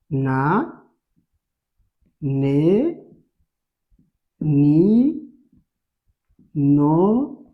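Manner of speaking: isolated syllables, spaced well apart; syllable drill. Shown as a demonstration. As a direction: recite each syllable slowly for a class to follow. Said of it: "on va trouver". (syllabes égrenées lentement, une par une)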